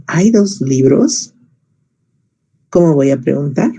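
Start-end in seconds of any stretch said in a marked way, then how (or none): 0.1-1.3 slow question. Shown as a demonstration. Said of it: "Quiero preguntar".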